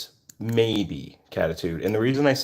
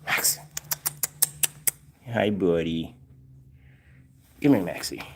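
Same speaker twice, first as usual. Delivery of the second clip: soft, gentle pet talk; calm the scared cat softly; 6 dB quieter than usual. Real, not acted. Talking softly to frightened cat.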